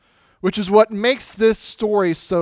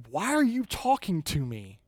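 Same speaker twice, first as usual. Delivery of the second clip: puzzled, breaking an awkward silence; wary Samaritan woman; 6 dB quieter than usual. Acted. Samaritan woman answers Jesus after an awkward silence, wary and incredulous. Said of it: "So finally, she says".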